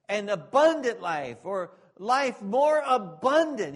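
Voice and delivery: loud, ringing pulpit voice with rising fervour; preaching at full force. Acted. Preacher urging believers to leave sin, loud and fervent. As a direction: drive the point home to the congregation.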